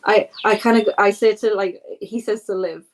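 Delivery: usual speaking voice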